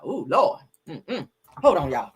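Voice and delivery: normal talking voice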